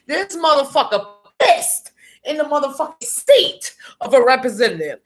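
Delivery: usual speaking voice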